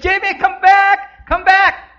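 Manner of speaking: very high, sustained calling-out; screaming mother. Acted. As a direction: scream for your child to return. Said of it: "the mother keeps screaming and screaming".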